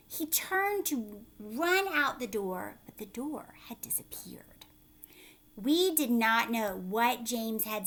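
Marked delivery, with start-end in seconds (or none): 2.9-4.7 hushed spooky whisper. A narrator's reading. Teacher reading to children, hushed whisper. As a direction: breathe it out like a secret. Suspense in read-aloud story.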